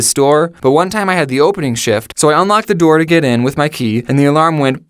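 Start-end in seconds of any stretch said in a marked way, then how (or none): none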